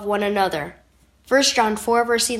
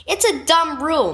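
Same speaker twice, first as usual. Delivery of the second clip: usual speaking voice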